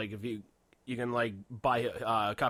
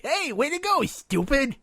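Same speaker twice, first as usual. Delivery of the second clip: much higher, loud cartoon mockery; Homestar Runner impression; 8 dB louder, slower than usual. Homestar Runner mocking his friend, high and loud. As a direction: squeak it out like a goofy cartoon. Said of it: "would probably sound more like Homestar Runner".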